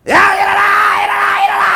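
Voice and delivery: high, loud repeated yell; full-force wake-up shout. Shown as a demonstration. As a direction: scream them out of bed. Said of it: "그래야 일어날까 말까 하지".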